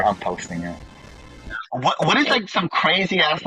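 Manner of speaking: ordinary talk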